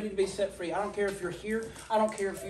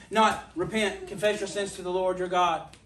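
ordinary talk, nothing marked

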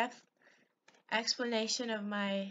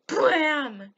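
loud burst mimicking a gun blast; imitated gunshot; 3 semitones higher, 7 dB louder than usual. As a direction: fire the gun with your mouth.

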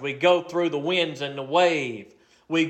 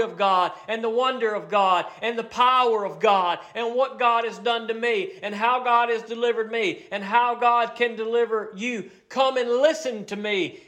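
preaching at full force; 8 semitones higher than usual. Acted. The psalmist calling all who fear God, fervent preaching. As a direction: summon them like a revival preacher.

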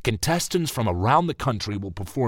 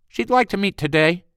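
high, tight clipped secretary; a tight-throated office assistant; pinched assistant voice; 10 semitones higher, 4 dB louder than usual. Acted.

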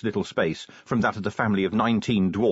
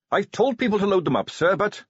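put-on higher eager young Carrot voice; 9 semitones higher than usual.

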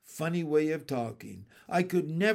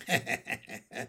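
low staccato laugh; soft, raspy cackle; bullfrog chuckle; 4 semitones lower, 5 dB quieter than usual. A narrator's reading. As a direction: chuckle dismissively as a frog.

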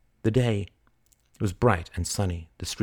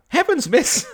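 high, loud vendor's exclamation; alarmed cry; 6 dB louder than usual. Acted. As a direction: cry out in startled dismay, pitch leaping. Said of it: "cried the man".

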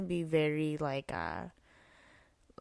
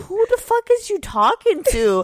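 high, loud, indignant clapback; sassy confrontation; 14 semitones higher, 12 dB louder than usual. Retold or imitated. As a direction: put them in their place, loud and high.

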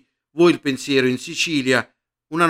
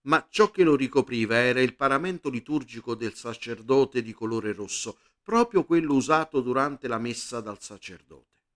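plain reading voice

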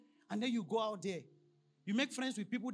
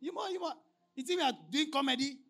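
higher, incredulous you think we are doing comedy; scornful retort; 6 semitones higher than usual. Retold or imitated. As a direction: scoff in disbelief. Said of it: "That's what their answer says".

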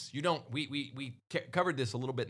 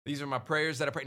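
raised-pitch country churchgoer; country accent; 4 semitones higher, 3 dB louder than usual. Acted. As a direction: list it off in a put-on country accent. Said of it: "I'm doing a country accent".

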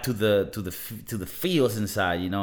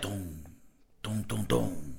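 ominous music sting; 4 semitones lower, 10 dB quieter, slower than usual. Retold or imitated. Imitating ominous horror music sting.

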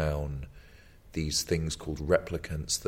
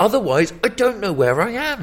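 mock confused viewer; 8 dB louder than usual. Mock confused French film viewer.

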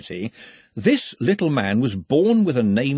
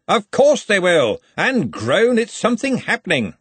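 high, emphatic dismissive reply; snorting retort; 10 semitones higher, 4 dB louder than usual. A narrator's reading. Ridcully answers Ponder's doubt about the crowd, snorting. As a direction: scoff with raised pitch. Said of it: "Ridcully snorted".

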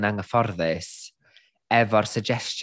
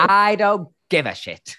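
slow, higher, louder I don't give a shit; drawn out like a sassy diva; hammed-up drag queen attitude; mock defiant declaration; 8 semitones higher, 4 dB louder, slower than usual. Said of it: "sut oedd hi dweud".

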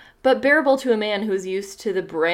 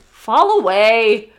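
cheerful; 8 dB louder, much slower than usual. Acted. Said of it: "Esteban answered cheerfully".

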